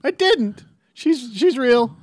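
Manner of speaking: a weak take at Morty; half-hearted high Morty voice; bad Morty voice. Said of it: "That was a bad Morty".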